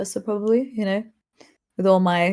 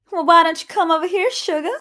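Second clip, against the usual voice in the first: put-on higher-pitched Southern American accent; 7 semitones higher, 3 dB louder than usual. Ivy showing off her best American accent, playful Southern accent. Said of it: "let's hear your best American accent".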